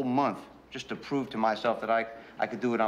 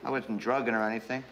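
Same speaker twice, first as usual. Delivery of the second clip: ordinary talk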